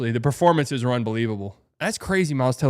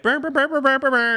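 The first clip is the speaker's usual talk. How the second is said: high-pitched stuttering brr brr; high trumpet buzzing; 12 semitones higher, 3 dB louder than usual. Retold or imitated. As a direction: buzz out bad trumpet notes. Imitating kid's trumpet playing.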